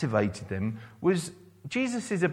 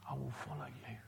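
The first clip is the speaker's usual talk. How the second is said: a breathy private promise; hushed whispered prayer; whispered vow; 3 semitones lower, 18 dB quieter, faster than usual. Acted. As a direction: whisper it, almost to yourself. Voicing the believer's response.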